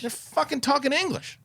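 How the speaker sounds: can't believe they speak English; incredulous disbelief. Real, not acted. Exasperated about German soldiers speaking English.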